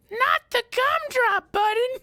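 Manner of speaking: very high, slow squeaky cry; Gingerbread Man squeal. Retold or imitated. Streamer quoting the Gingerbread Man, high squeal. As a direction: plead like a tiny cookie.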